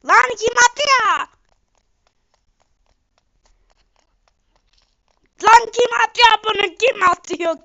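loud, high furious shouting; angry yelling. Real, not acted. Gamer yelling at players throwing things, furious. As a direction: bellow at the attackers. Keeps getting hit by throwers.

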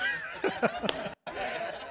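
squealing laugh; high laugh. Real, not acted. Big laugh.

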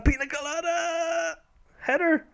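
high, stretched name call; sing-song commentator call. Pretend. Calling player's name.

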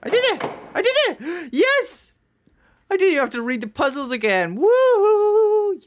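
high, thrilled cheering; elated celebration. Real, not acted. Player solved the puzzle without rereading notes, elated. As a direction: cheer in triumph, building to a whoop.

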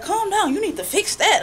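a hint of someone talking down to her; mock condescending. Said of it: "that person like".

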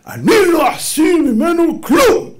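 high, loud, slow proud declaration; hammed-up doting parent shouting; boastful indulgent parent. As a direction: proclaim it like a spoiling parent. Mocking indulgent parent's creed.

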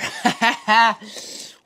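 high, loud delighted laugh; excited laugh. Real, not acted. Delighted after eating.

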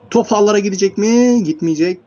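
loud, high suspenseful question; excited shot call. Real, not acted. Commentator asks if the ball will go in, excited call. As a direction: raise the voice as the shot flies.

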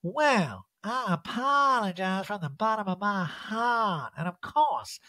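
put-on voice for a grand apology; hammed-up languid contrition; Erica. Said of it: "Hello, Erica".

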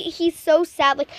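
ordinary talk, nothing marked

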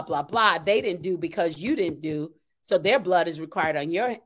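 usual speaking voice

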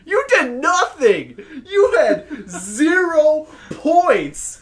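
high, loud outraged rant; indignant yelling. Acted. Player berates teammate for zero points, indignant yelling. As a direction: yell it in disbelief, stretching key words. Mock tirade at scoreless teammate.

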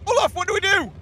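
high frantic plea; panicked cry. Real, not acted.

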